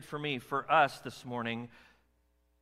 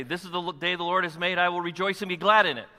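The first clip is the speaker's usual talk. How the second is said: loud joyful declaration; 3 semitones higher, 7 dB louder than usual. Shown as a demonstration. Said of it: "Turn to the person to your left and say".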